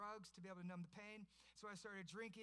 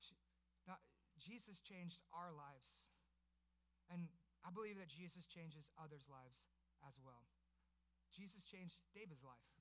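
normal talking voice

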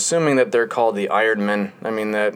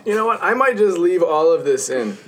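laughing; 8 semitones higher, 5 dB louder than usual. Real, not acted. Giddy after laughter.

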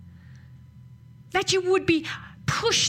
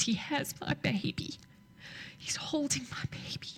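tearful whisper; 3 semitones higher, 11 dB quieter than usual. Retold or imitated. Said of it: "then she started weeping".

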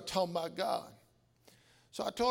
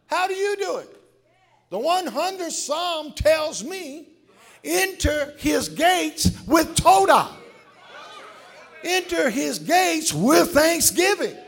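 loud, rising sermon shout over music; loud gospel preaching; full-force preaching; 6 semitones higher, 14 dB louder, slower than usual. Acted. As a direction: preach it big to the whole church.

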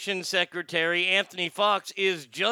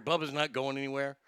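usual speaking voice

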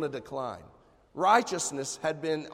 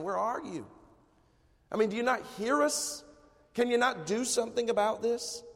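higher-pitched frustrated appeal, acted; pleading cry; 7 semitones higher than usual. Frustrated people crying out to God for help, pleading. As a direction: plead upward in mild desperation, without shouting. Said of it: "probably crying out to God saying".

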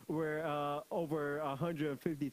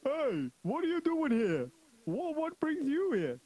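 high, loud guard's challenge; challenging soldier; 9 semitones higher, 5 dB louder than usual. Acted. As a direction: shout at an intruder like a guard. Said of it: "One of the soldiers saw him and said".

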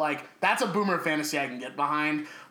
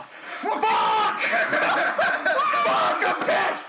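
hammed-up furious shout, much higher and louder; mock rage; 5 dB louder, much slower than usual. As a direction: blow up in pretend fury, laughing through it. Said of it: "being so mad that I have to go".

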